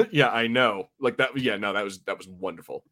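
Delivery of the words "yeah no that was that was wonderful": ordinary delivery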